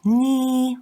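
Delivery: "ni" held high, straight and long; long, level, high-pitched "ni"; first tone. Shown as a demonstration. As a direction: sustain "ni" on one high level note. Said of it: "pronounced with the first accent".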